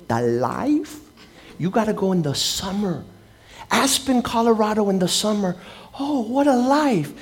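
lightly voiced wealthy gentleman dreaming aloud; a hint of a rich man sighing over the summer life; dreamy rich man. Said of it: "He goes".

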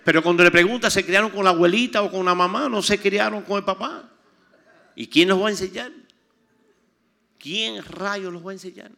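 loud fiery preacher, shouting it out; loud preaching; full-force preaching. Acted. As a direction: preach it to the back row at the top of your voice.

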